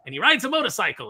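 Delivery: high, loud selling; excited pitch. Retold or imitated. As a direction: pitch the cool detail. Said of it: "they'd go".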